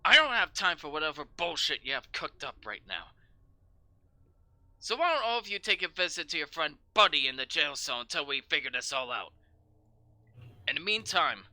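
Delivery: loud commanding orders; barking sergeant. Acted. Sergeant ordering guards to jail the newcomers, loud.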